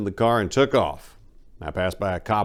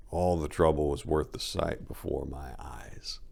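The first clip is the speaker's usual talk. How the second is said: hushed awe; 4 semitones lower, 6 dB quieter than usual. Real, not acted. Awed by the view.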